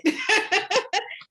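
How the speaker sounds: bursting out in a high laugh; high cackling laugh. Real, not acted. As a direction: cracks up high and loud. Co-host cracks up.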